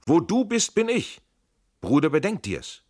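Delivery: very high, loud exclamation; urgent calling. A narrator's reading. Two comrades fleeing the fortress, calling urgently. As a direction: cry it out to a comrade in flight. Acted urgent exchange during escape.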